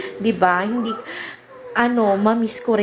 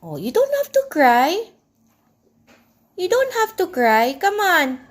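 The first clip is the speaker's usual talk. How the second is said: much higher, sing-song comfort; 10 semitones higher than usual. Real, not acted. Mother hushing her crying daughter, high and soothing. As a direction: lift the voice to calm her tears.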